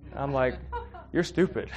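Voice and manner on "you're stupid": laughing, high blunt insult; laughing jab. Retold or imitated. To the coworker, laughing jab. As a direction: tease with a laugh in the voice.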